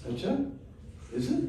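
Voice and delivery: mock-amazed high query; surprised. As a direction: react in wide-eyed disbelief. Nanda Baba reacts to Prayag's answer.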